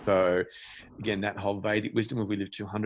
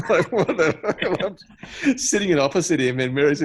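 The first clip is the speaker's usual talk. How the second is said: much higher, laughing through the words; 8 semitones higher, 8 dB louder than usual. Real, not acted. Laughing about holding hands in the food court.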